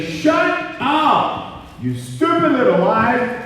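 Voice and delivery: high, loud telling-off; defiant command. Acted. Speaker talks back to negative lies, defiant and loud. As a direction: shout the lies down with force.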